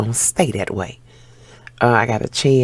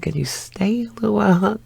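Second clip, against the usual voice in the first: high, coaxing plea; pleading ask; 9 semitones higher than usual. Retold or imitated. Woman asking her new man to stay, high coaxing plea. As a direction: sweetly beg him to stay a bit.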